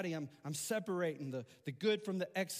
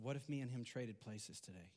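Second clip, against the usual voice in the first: put-on soft boy's question; 5 semitones lower, 7 dB quieter than usual. Boy proposes trading places.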